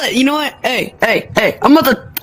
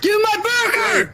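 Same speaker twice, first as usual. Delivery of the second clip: shouting demand; 10 semitones higher, slower than usual. Acted. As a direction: demand the burger in a silly shout. Demanding the burger.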